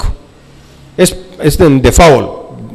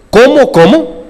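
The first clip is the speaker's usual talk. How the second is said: high rising squeak on ¿Cómo, cómo?, pitch jumping up; imitating his teacher; 9 semitones higher, slower than usual. Preacher mimicking his old teacher, high rising question. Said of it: "como decía mi maestro".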